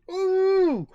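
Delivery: very high, very loud hammed-up howl; exaggerated comic cry of pain; mock pain howl. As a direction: shriek like it really hurts. Joke patient touches chin.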